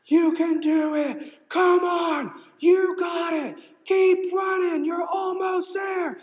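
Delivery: very high, loud shouted cheering; letting loose cheering. Retold or imitated. Friend at the finish line screaming for the runner, very high loud shouts. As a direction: scream encouragement at a tired runner. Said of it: "she is just letting loose".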